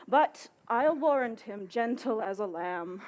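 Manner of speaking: usual speaking voice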